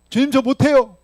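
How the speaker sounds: high, loud pleading cry of refusal; whining refusal. Acted. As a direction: beg off like a stubborn servant.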